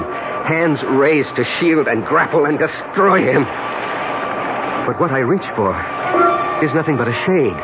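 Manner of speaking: narration climbing in pitch to a climax; dramatic story narration. Acted. Man narrates his plunge to death, mounting intensity. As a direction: build steadily towards the final words.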